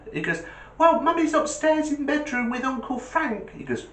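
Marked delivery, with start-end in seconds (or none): 0.8-3.5 put-on very high, innocent child; small boy blithely reporting to dad; high kiddie voice; little voice. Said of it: "this little voice goes".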